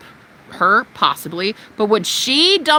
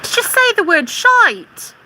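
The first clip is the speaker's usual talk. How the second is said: mocking prissy troll; 8 semitones higher than usual. Mimicking a troll who scolds her swearing, high and whiny. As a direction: sneer it like a scandalised prude.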